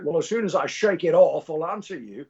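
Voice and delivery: lightly voiced Scottish star, dry comeback; a hint of celebrity impression; Sean Connery. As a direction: imitate a Scottish film legend's retort. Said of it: "and he's like".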